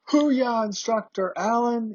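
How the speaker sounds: high-pitched chanted mock cheer; imitated class yell. Whole class greeting the instructor in unison, high mock yell. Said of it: "the whole class in unison yells".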